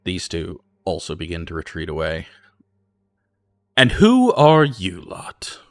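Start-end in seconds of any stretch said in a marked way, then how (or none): 3.8-5.6 slow, loud lordly challenge; stern slow demand. Acted. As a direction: demand it like a suspicious mayor. Lord mayor demands names.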